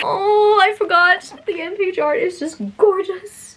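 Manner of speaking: squealing rediscovery of the art; excited realization. Real, not acted. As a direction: burst out as you remember. Suddenly remembering end page art.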